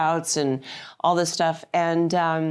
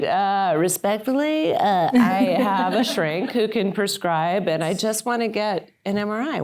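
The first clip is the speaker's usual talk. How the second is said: polite but firm pushback; 4 semitones higher than usual. Retold or imitated. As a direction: push back politely without giving in. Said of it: "And I was like".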